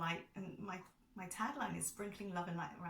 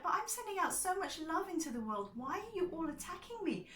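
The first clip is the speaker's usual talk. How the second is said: higher and louder, wounded disbelief; hurt protest; 11 semitones higher, 4 dB louder than usual. Retold or imitated. Answering the commenters, a high hurt protest. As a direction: plead like you can't understand the cruelty. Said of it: "And it's like".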